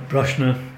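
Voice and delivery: Broshna with sh for the s, "BROSH-na"; his own pronunciation. Shown as a demonstration. Storyteller gives his generation's way, Broshna with sh. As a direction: say Brosna with a sh in the middle. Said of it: "as I would say".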